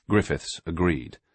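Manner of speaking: ordinary delivery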